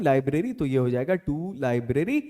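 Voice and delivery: ordinary talk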